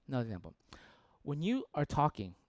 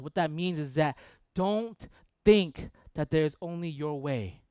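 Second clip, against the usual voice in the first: normal talking voice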